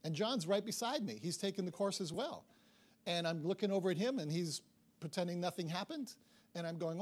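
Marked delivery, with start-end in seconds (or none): none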